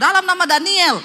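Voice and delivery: loud, high proclamation; mock exorcism command. Preacher tests his own name on the possessed, loud mock command. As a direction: call out the name as a bold command. Demonstrates a useless deliverance command.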